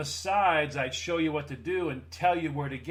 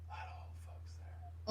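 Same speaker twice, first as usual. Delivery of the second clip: breathy whisper, no voice at all; whispered aside; 14 dB quieter than usual. Pretend. Whispering the aside to the listener.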